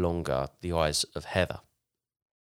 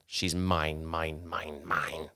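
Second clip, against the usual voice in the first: taunting demon voice; 3 semitones lower, 3 dB quieter, much slower than usual. Retold or imitated. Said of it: "cackling and taunting".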